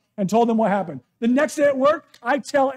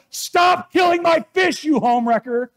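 imitating sleep yell; 7 semitones higher, 7 dB louder than usual. Pastor imitating his wife yelling at the eel in her sleep. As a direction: belt it out like a sleep-talking wife. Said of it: "She yelled".

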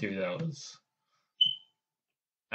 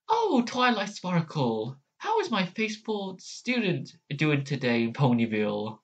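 Celestia; 5 semitones higher than usual. Acted. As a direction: draw out the greeting like a gracious princess. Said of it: "let me try that again".

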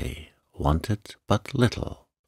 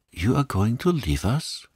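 dismayed cry; 6 semitones higher than usual. Acted. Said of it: "cried Renée, unable to hide her emotion".

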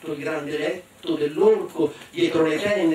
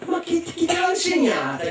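ogre amazed; 13 semitones higher than usual. Acted.

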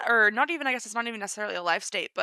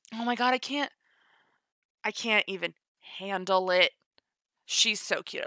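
usual speaking voice

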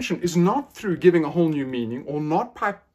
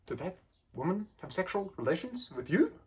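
playful reversal; 7 dB quieter than usual. Shown as a demonstration. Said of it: "being a little bit playful".

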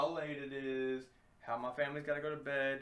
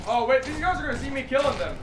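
fast, loud and high; excited panic; 8 semitones higher, 10 dB louder than usual. Real, not acted. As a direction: blurt it out in a rush. Panicked gaming reaction.

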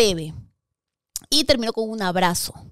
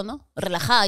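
ordinary talk

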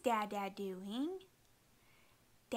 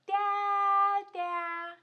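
loud playful call through a door; 6 semitones higher, 10 dB louder than usual. Pretend. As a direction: yell for daddy behind the door. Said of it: "we better yell for him".

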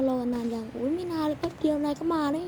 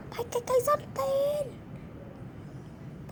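much higher, furious yell telling him to get lost; angry yell; 12 semitones higher than usual. Acted. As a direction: scream him out of the cinema. Staff throws loud viewer out.

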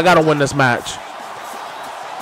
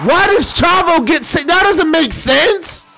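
exasperated yell; 11 semitones higher than usual. Real, not acted. Frustrated gamer yelling at screen.

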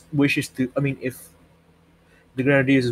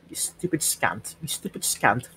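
soft, half-whispered insult; hushed cursing; 10 dB quieter than usual. Real, not acted. Player fighting an enemy alone, hushed cursing. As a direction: curse the enemy under your breath.

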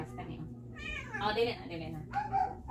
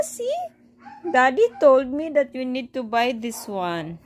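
usual speaking voice

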